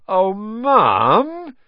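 loud, high, drawn-out wail of complaint; whining protest.

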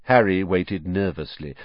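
ordinary delivery

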